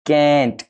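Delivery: "can't" said with firm pressure; stressed can't. Shown as a demonstration. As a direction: lean hard on "can't". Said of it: "इसको हम ज्यादा जोर देंगे".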